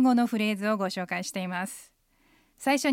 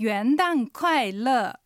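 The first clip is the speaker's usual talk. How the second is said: very slow, each syllable drawn out; slow model pronunciation; much slower than usual. Shown as a demonstration. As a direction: model it slowly for learners to repeat. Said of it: "今日のセンテンス復習しましょう". (一音ずつゆっくり手本の発音)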